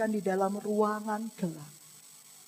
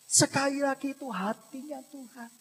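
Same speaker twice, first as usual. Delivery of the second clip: usual speaking voice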